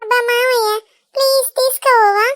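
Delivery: put-on begging child.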